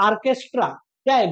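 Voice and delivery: ordinary talk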